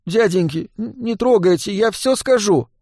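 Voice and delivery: high, stammering plea; feigned terrified wailing. A narrator's reading. Boy begging thugs not to hurt him, feigning terror. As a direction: wail it high, faking fright. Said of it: "изобразил на лице крайний испуг и запричитал".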